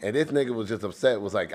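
ordinary talk

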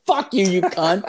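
high, loud mock yell through laughter; gloating taunt. Storyteller getting back at the girl, gloating and laughing. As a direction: crow it in a high voice, cracking up.

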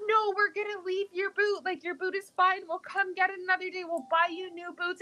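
high, hurried reassuring; frantic mom. Retold or imitated. Mom quoting herself refusing to go back for the boot, high hurried voice. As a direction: talk her down while fleeing.

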